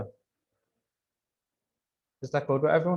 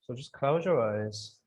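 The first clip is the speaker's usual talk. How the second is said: calm grounding exercise. Acted. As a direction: ease them into calm breathing. Leads grounding meditation.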